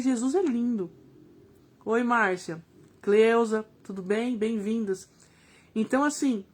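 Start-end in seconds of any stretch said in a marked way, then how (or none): none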